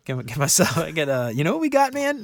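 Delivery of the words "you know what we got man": excited. Real, not acted. Excited about snack.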